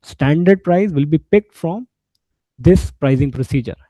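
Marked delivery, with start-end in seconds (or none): none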